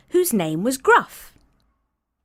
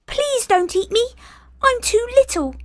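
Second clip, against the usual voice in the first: much higher, louder tiny goat voice; small voice; 4 dB louder than usual. Acted. Littlest goat telling the troll where he goes, tiny and high. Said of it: "said the billy goat".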